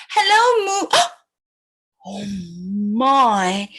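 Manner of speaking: ordinary delivery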